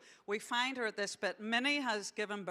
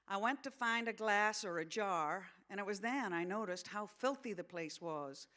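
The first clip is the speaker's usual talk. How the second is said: story narration in a put-on American accent; American accent reading; 3 semitones higher, 3 dB louder than usual. Author reading a novel to an audience, American accent. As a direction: read it aloud as an American. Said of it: "gonna read this in an American accent".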